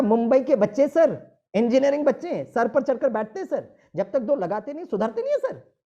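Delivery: yüksek perdeden, yüksek sesle kızgın karşı çıkış; sinirli itiraz. Retold or imitated. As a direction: doktora hararetle, bağırarak itiraz et. Retorts heatedly to the doctor.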